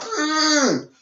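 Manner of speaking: long, very high strained "mm"; mock straining groan. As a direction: squeeze out a mock groan of effort. Imitates the botched submission hold.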